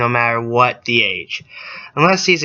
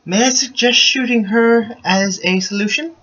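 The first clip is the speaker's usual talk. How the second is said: mock polite; 9 semitones higher than usual. Real, not acted. Sarcastic suggestion.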